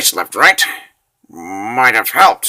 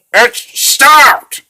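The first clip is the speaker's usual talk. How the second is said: high loud panicked cry; 12 semitones higher, 5 dB louder than usual. Acted. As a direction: gasp it out in sheer panic. Hero feels his heart stop.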